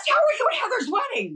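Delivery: high-pitched, amazed question; real astonishment. Real, not acted. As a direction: ask it in delighted disbelief, pitch soaring. Astonished at shared wedding connection.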